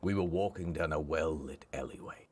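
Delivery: low, hushed, brooding storyteller; mock-tragic flashback narration. Narrator recalls a fateful alley walk after the opera, brooding. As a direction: recount it gravely, with suspenseful pauses.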